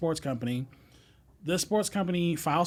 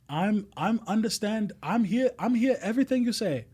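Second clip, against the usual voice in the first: a rough, higher-pitched imitation of a German accent in broken English; Louis's German accent; 6 semitones higher than usual. Imitating his German boss Louis insisting he understands, in a mock German accent. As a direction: butcher a German boss's accent on purpose, pitched up. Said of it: "I'm gonna butcher this, this accent".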